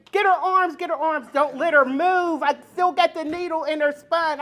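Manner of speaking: high, loud, panicky doctor; urgent orders. Retold or imitated. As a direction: call out frantic orders to the nurses. Acts doctor's urgent orders during delivery.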